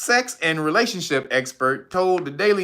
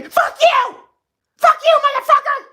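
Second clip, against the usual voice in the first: high screeching mock woman yelling; 5 dB louder than usual. Woman screaming back at a man who said hi, high mock yell.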